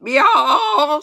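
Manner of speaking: loud, high cow bawl; calf's bellow. A narrator's reading. Startled calf bolting with the sled, loud bawl. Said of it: "I've never heard a cow make these noises".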